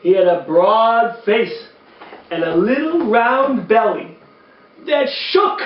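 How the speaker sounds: lively, sing-song storybook reading; reading to children. A narrator's reading. Uncle Mitch reading a Christmas poem to kids, animated storytelling. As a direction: read it like bedtime story time for kids.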